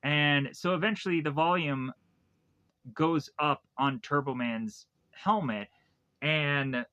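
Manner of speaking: ordinary talk, nothing marked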